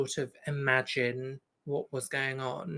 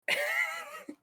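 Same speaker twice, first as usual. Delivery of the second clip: high squeaky laugh; 5 dB quieter than usual. Real, not acted. Laughs while hesitating.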